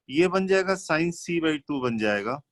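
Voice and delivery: ordinary talk, nothing marked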